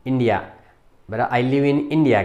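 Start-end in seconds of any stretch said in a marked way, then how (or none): none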